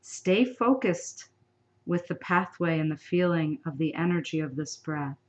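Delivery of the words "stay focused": ordinary talk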